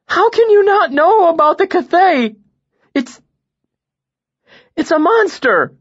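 high, loud, desperate pleading; frantic pleading with his teacher; distraught. A narrator's reading. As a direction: voice strained near tears.

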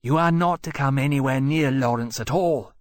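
loud snarling dragon threat. A narrator's reading. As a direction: snarl it out savagely. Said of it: "Temeraire snarled".

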